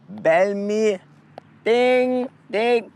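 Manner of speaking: high sung dings. Acted. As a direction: chime like a notification bell. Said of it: "vlogger outro bell".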